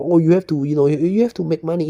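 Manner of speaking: mock naysayer. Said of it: "mocking naysayers".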